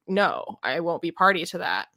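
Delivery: ordinary talk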